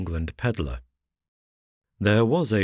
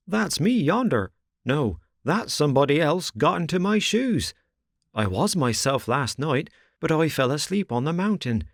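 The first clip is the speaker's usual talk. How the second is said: higher, building confused rambling; bewildered panic; 9 semitones higher than usual. A narrator's reading. Rip telling the crowd he is not himself, building panic. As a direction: let the confusion spill out faster.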